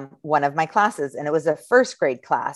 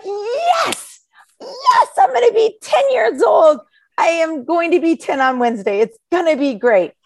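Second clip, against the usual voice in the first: high, loud laughing agreement; mock thrilled; 12 semitones higher, 4 dB louder than usual. Teacher joking with kids about turning ten, high and shouting. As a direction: gleefully play along with the wrong answer. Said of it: "And I'm like".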